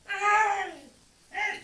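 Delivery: high, loud shout after someone; David shouting after the departing king, loud high call. Acted. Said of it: "le empezó a gritar".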